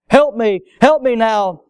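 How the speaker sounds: mock cry for help. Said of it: "I cried".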